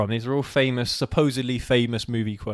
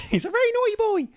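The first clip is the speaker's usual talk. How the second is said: very high, hammed-up mother's shriek; mock shrill mum voice; 4 dB louder than usual. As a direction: squeal it like a scolding mum. Echoing the famous movie quote.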